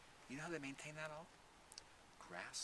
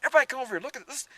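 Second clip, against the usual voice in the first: loud, high call to the crowd; 13 semitones higher, 20 dB louder, much faster than usual. Retold or imitated. Farmer calling visitors to see the boy, loud call. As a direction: call everyone over excitedly.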